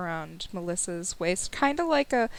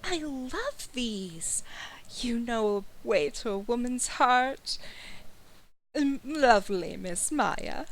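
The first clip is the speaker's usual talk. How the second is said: soft, higher, savoring voice; softly gushing, higher-pitched lady; delighted lady; 4 semitones higher, 4 dB quieter than usual. Acted. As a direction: coo over a gift, drawing out the pleasure. Melissa delighted by gift of chocolates.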